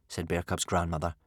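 usual speaking voice

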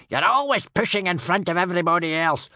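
high, loud, indignant scolding; roared complaint. A narrator's reading. Rival warrior scolds the queue-jumper, roaring. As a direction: bellow at the pushy one. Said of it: "roared Tough Nut Senior".

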